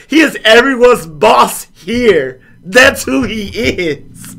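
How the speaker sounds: high, loud, slow exasperated insistence; exasperated actors. Retold or imitated. Castmates explaining Nick Fury, high loud exasperation. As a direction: hammer the obvious home. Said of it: "She was like".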